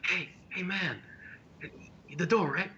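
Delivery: high-pitched pretend whispering voice; mock whisper. Game master voicing the whisper about the door, high mock whisper. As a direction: imitate a whisperer in a small high voice. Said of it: "You just hear a whisper".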